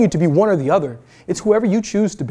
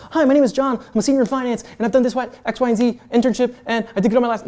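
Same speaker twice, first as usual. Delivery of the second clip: higher-pitched mock eager student; resume spitter; 5 semitones higher than usual. Student reciting a resume to a recruiter, mock recital. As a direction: reel off a resume in a raised voice. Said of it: "not going out there spitting a resume".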